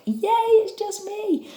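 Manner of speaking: high-pitched playful "yay"; gleeful cheer. Pretend. Acts out happy reaction to costume.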